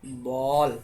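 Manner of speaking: बॉल said slowly, vowel between aa and o; sound between aa and o. Shown as a demonstration. Teacher repeating बॉल with the vowel between aa and o. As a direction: model बॉल, vowel neither aa nor o. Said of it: "कैसे?".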